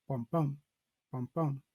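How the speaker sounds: "bum, bum" in pairs, unstressed then stressed; iambic beat. Shown as a demonstration. Teacher shows the iambic pattern, "bum, bum" weak then strong. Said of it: "that iambic pattern there".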